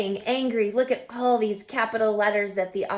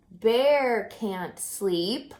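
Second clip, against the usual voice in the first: drawn-out repeat; much slower than usual. Acted. Refrain reread slowly.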